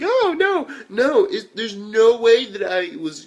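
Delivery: loud, high, overacted denial; mock protest. Captured spy badly denying she was paid, hammed-up protest.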